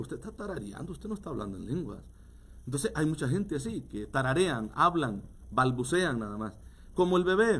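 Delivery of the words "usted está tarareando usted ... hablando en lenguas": much softer, firm correction; quiet firm correction. Retold or imitated. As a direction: set her straight quietly. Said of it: "Me dice". (corrección firme en voz baja)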